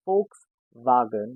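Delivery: Volkswagen slowly, as "FOLKS-vah-gen"; slow German Volkswagen, V as f; German pronunciation. Shown as a demonstration. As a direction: break Volkswagen in two, f then v. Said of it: "we say it Volkswagen".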